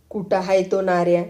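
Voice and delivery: usual speaking voice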